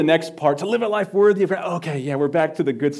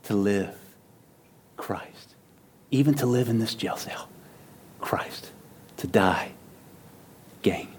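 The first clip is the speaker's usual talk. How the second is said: hushed quote; 3 semitones lower, 5 dB quieter than usual. Shown as a demonstration.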